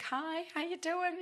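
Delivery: high, slow gentle greeting voice; a light, friendly God saying hello, drawn out; God greeting her. Retold or imitated. Woman voicing God greeting her tenderly, high and slow. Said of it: "I feel like God saying".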